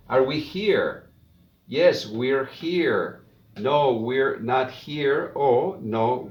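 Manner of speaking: slow, clear model reading; slow practice reading. Shown as a demonstration. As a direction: read each line slowly for students to repeat. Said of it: "Ahora ya no voy a traducir, solo voy a leer".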